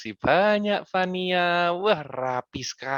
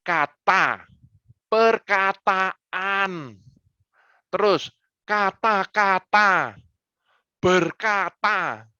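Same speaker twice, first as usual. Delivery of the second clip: "perkataan", "kata-kata", "berkata" each drawn out very slowly; slow affix demo; 3 semitones higher, much slower than usual. Shown as a demonstration. Coach slowly showing affixes to a pupil. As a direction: stretch every affixed word slowly. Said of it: "itu yang bikin bingung".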